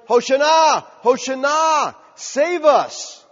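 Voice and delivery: high, loud calls with long vowels; crowd shouting. Acted. Preacher voicing crowds calling to Jesus on the road, shouting. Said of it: "the crowds are shouting".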